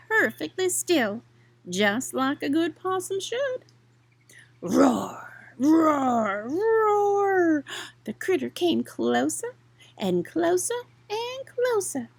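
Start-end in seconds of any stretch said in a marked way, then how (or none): 4.6-7.6 growled creature roar, rising; mock roar building up. A narrator's reading. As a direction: roar like a big swamp cat. Creature roars approaching.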